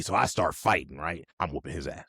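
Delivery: ordinary talk, nothing marked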